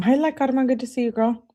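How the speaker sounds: high-pitched, friendly hello; friendly greeting. Real, not acted. Breaks off to greet someone arriving.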